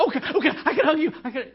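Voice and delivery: high, loud hammed-up panic; frantic husband scrambling to comply, retold; panicked agreement. Panicked husband answering crying wife.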